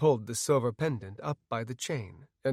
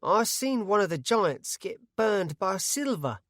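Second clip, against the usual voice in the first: slow, higher rustic voice; blurted; 7 semitones higher than usual. A narrator's reading. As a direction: blurt it out nervously. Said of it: "he blurted".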